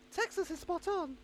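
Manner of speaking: high lady voice, half-hearted; lightly voiced lady; man doing a woman's voice. Shown as a demonstration. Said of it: "I'm not doing the voice".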